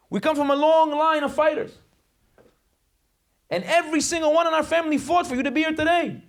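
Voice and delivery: high-pitched rallying sermon; impassioned preaching. Real, not acted. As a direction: rouse the congregation with sustained high pitch.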